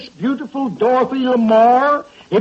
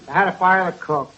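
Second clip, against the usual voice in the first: normal talking voice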